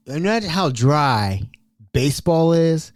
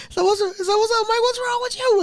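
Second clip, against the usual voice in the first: much higher squeaky voice asking what's up; squeaky suspicious guy. Retold or imitated. Mel asking Mike why he acts weird, squeaky mock voice. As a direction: play it shrill and puzzled. Mel questions odd behaviour.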